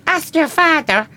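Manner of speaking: put-on high, loud, drawn-out Irish accent; Irish mum. Daughter quoting mum's answer to her upset, loud Irish accent. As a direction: imitate an Irish mother calling out, pitched right up. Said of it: "She was Irish".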